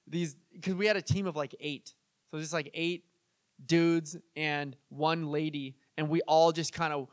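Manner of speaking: ordinary delivery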